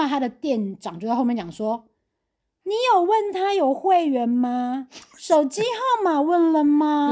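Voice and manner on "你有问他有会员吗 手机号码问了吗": high, slow nagging boss voice; scolding manager. Retold or imitated. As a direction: nag like a bossy store manager. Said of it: "就是用那种数落他". (唠叨训人的店长口吻)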